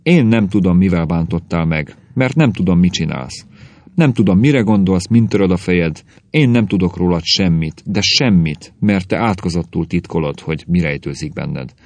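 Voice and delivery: usual speaking voice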